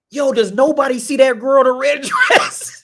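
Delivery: high, loud, laughing disbelief. Retold or imitated. Gamer spotting the girl in red, loud laughing disbelief. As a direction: call out like nobody else sees her. Recalling seeing the ghost girl.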